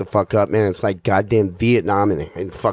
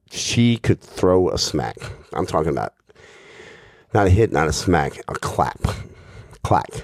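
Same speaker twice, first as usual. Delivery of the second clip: usual speaking voice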